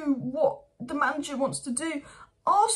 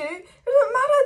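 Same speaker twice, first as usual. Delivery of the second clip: higher and louder, voice breaking in frustration; cracking shout; 9 semitones higher, 8 dB louder than usual. Real, not acted. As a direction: scream it out, at the end of your rope. Fan ranting, voice cracking.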